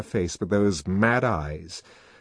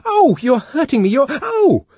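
high, loud cry of pain and fright; high, loud pained cry as her wrists are gripped; 10 dB louder than usual. Acted. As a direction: yelp as he hurts her. Savage grips her wrists roughly.

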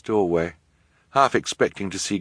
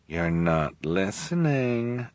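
slow, softer, dismissive correction; politely impatient; 4 dB quieter, much slower than usual. Acted. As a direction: brush him off with cool patience. Esme brushing off Jerome.

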